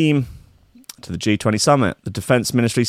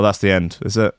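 usual speaking voice

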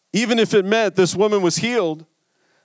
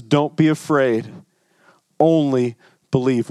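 calm reassurance; 5 semitones lower than usual. Retold or imitated. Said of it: "Jesus looks at him and says".

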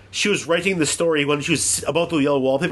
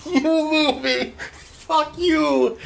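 high, laughing frustrated outburst; exasperated yell at movie; 13 semitones higher, much slower than usual. Real, not acted. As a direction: yell at the screen, half laughing. Yelling at the bad movie.

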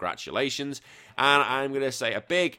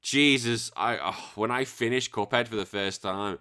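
normal talking voice

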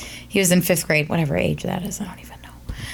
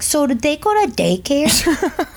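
imitated little kid, higher and slow; preschooler; 7 semitones higher, slower than usual. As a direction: ask it slowly, like a curious preschooler. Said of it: "he goes to preschool, and he's like".

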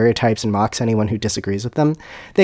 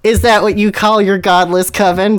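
higher, louder knowing question; knowing query; 8 semitones higher, 5 dB louder than usual. Acted. Dumbledore questions the godless coven, knowingly. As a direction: ask it with pointed knowingness. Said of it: "Dumbledore queried knowingly".

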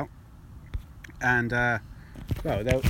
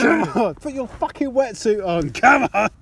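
loud, high angry yelling; aggressive shouting; 9 semitones higher, 8 dB louder than usual. Pretend. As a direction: bark orders like a drill sergeant. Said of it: "You're very aggressive".

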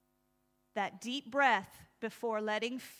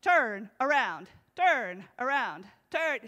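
frantic GPS voice; 7 dB louder than usual. Retold or imitated.

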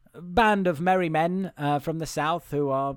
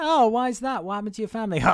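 lightly put-on, higher questioning voice; acted concerned question; 6 semitones higher, faster than usual. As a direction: ask the boy with mock concern. Acted dialogue with the boy.

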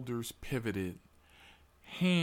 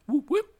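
siren whoop; 10 dB louder than usual. Retold or imitated. Imitating a police siren.

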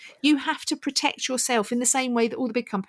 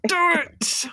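hyped-up cheer; excited shout; 7 semitones higher, 5 dB louder than usual. Pretend. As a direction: shout it with glee. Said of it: "You're really enthusiastic today".

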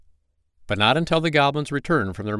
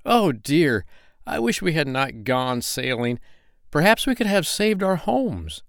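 higher, lamenting little goblin; regretful goblin; 6 semitones higher than usual. Acted. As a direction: sigh with regret, rising.

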